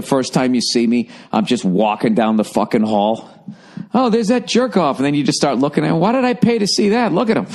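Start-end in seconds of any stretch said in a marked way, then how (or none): 3.9-7.5 mock audience member. Imagined audience mocking him.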